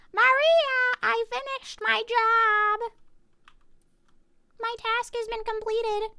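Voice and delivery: high, drawn-out singsong report; high put-on voice announcing to someone away; calling out to Maria. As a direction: call it out high, slow and stretched.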